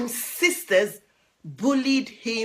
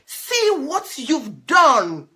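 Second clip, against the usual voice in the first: reproach; 4 semitones higher, 7 dB louder, slower than usual. Acted. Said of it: "Sparrow to the ducklings".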